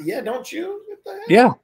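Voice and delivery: high, lightly put-on voice; retold exchange. Teacher retelling the students' reaction in a high voice. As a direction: toss back the retort, then mock the kids' reaction. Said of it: "my students are like".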